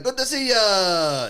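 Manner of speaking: high, slow, cheery greeting; imitating a man in a great mood; Shoes. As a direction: stretch it out as an overjoyed hello. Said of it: "He was in the best mood all the time".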